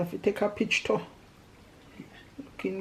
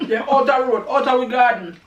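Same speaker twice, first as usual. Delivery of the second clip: laughing, louder and higher; laughing repeat; 5 semitones higher, 9 dB louder than usual. Shown as a demonstration. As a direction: echo it through a laugh. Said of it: "laughs, repeats it".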